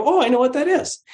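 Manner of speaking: high-pitched "oh, got it"; sudden recognition. Acted. Teacher acts out a learner understanding a new word, sudden recognition. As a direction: voice leaps up in recognition.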